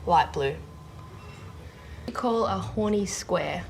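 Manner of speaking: ordinary talk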